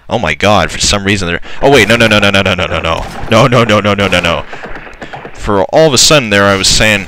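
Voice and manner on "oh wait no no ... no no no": panicked no. Real, not acted. Battle suddenly going badly.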